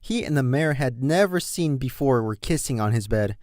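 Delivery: ordinary delivery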